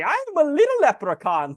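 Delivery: high, laughing mock Irish leprechaun voice; shitty Irish accent. Tourist in green playing leprechaun, laughing in a fake Irish accent. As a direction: squeak it out as a cheesy stage-Irish leprechaun. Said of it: "do your best shitty Irish accent".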